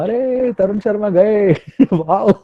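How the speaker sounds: loud, laughing wow at a death; mock wow. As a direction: gleefully shout wow at the wrong moment. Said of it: "मत बोलना". (मौत पर ज़ोर से हँसता वाओ)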